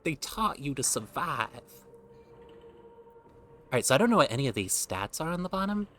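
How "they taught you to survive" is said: put-on much higher urchin voice in a mixed British and Southern accent. Reading the urchin backstory, high British-Southern urchin accent. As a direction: squeak it out like an orphan from old British shows. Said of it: "a mix between British and Southern U S".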